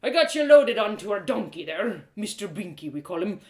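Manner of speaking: put-on loud, rough old smith spinning a yarn; a hearty old man recounting the rescue; gruff blacksmith, storytelling.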